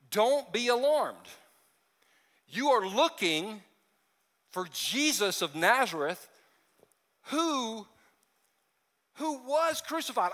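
slow, rising pulpit reading; slow, lifted reading of Scripture. Acted. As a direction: read the angel's words slowly, building. Reading angel's words slowly.

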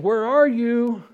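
loud, slow, high calling out; booming divine call; God calling out. Acted. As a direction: call out across the garden. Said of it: "the Lord called out to the man".

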